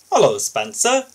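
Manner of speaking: loud, high jovial hello; the boss hailing an engine; booming greeting. Acted. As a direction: boom out a hearty welcome. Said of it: "the fat controller boomed".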